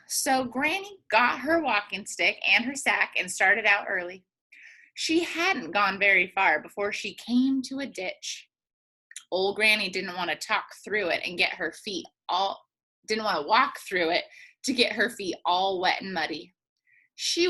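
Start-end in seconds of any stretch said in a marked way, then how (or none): none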